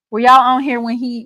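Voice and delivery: usual speaking voice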